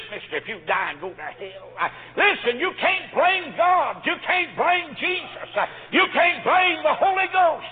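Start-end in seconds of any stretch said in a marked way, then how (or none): 2.2-7.7 high, loud, slow preaching; fervent exhortation. Real, not acted. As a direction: drive it home to the crowd.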